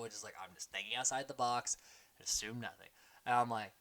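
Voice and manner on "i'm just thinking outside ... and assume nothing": low, soft parroting; imitated confessional. Player repeats advice in her confessional, quietly parroting. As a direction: quietly echo someone else's advice. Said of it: "cuts to her confessional".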